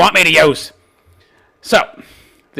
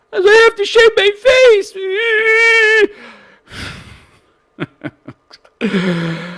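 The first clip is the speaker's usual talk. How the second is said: high fake crying voice; mock sobbing whine; 3 dB louder than usual. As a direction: blubber it out mockingly.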